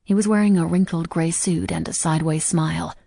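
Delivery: usual speaking voice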